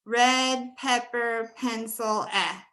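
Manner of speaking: "red pepper, pencil, eh", slowly, each part spaced out; slow drill. Shown as a demonstration. Teacher modeling her own vowel for "pencil" slowly. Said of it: "for me, it's red pepper".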